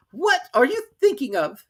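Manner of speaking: high, loud scolding; severe. Acted. Mouse scolding Alice, high and severe. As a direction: scold sharply.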